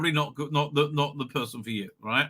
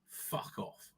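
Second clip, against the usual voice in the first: soft whispered dismissal; whispered fuck off; 18 dB quieter than usual. Real, not acted. Dismissing the agenda, a soft whispered fuck off. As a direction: breathe it out scornfully.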